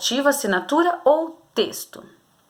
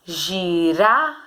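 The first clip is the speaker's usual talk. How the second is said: "Girafa" said slowly, syllable by syllable; slow sounding out; 4 semitones lower, much slower than usual. Shown as a demonstration. Teacher sounding out "Girafa" for pupils, slow. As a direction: draw out "Girafa" to find its strong syllable. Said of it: "falar com calma pra você descobrir".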